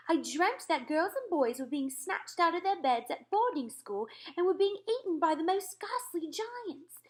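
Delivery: a queen still upset by a nightmare; queen's voice. Acted. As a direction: tell the dream as a rattled queen. Queen recounts her nightmare.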